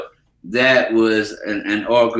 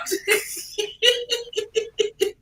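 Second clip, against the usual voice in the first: a high burst of real laughter; 6 dB quieter than usual. Real, not acted. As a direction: laugh hard at your own joke. Laughs at own joke.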